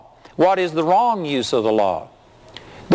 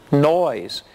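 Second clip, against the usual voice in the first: usual speaking voice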